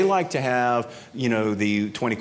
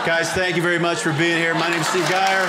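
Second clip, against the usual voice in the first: higher, louder thanks to the crowd; loud sign-off; 6 semitones higher, 4 dB louder than usual. Real, not acted. Comedian thanks the audience at the end, loud and bright. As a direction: close the show with energy. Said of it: "closing the show".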